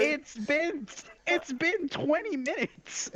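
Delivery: exasperated. Real, not acted. Exasperated complaint.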